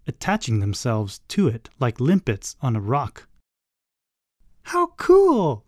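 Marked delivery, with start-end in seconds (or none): 4.6-5.6 very high, loud squeal of delight; high-pitched, delighted shriek; excited scream. A narrator's reading. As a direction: squeal it, thrilled. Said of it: "screamed Sam".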